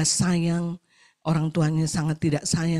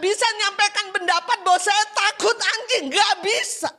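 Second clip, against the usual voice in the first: high-pitched, forceful preacher delivery; impassioned preaching; 14 semitones higher, 4 dB louder than usual. Real, not acted. Preacher tells congregation a servant cannot object, impassioned and high. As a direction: drive the point home at high pitch.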